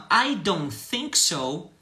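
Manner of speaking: slow, careful English model line; slow model reading. Shown as a demonstration. Teacher reading slowly to students. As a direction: spell it out slowly for learners. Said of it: "quando a pessoa fala assim".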